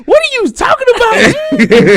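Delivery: high, shouted fake-polite voice; fake polite neighbor voice. Friend caught on speakerphone, high shouted fake neighbor voice. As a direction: squeal it like a startled phony neighbor. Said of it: "I hear him say".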